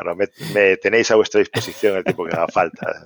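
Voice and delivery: ordinary talk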